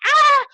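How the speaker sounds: high, loud panicky "ah"; imitated shriek of nerves; nervous yelp. Fan too shaky to approach his idol, nervous yelp. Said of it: "my nerves were like, like this".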